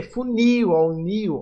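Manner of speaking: "funil" punched on final NIL, then NIL alone; stress on NIL. Shown as a demonstration. Teacher pointing out an oxítona, stress on NIL in "funil". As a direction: lean on the last syllable of "funil", repeat "nil". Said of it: "A última sílaba mais forte".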